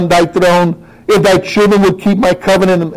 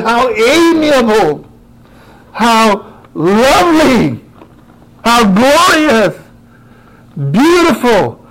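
rapturous; 7 semitones higher, slower than usual. Acted. Preacher exults over psalm words.

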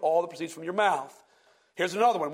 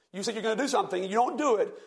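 ordinary delivery

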